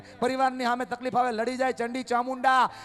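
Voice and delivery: loud, high preaching in full flow; fiery preaching. Acted. Speaker praising the women present, fiery preaching. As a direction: proclaim it like a sermon climax.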